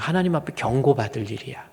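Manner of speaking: normal talking voice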